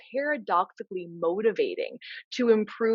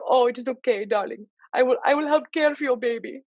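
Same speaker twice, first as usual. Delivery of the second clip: higher-pitched Nana in a put-on foreign accent; vague foreign accent; 6 semitones higher than usual. Nana reassuring her pregnant granddaughter, hammed-up foreign accent. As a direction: imitate a doting old-country Nana with an uncertain accent. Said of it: "I have no idea what accent that was".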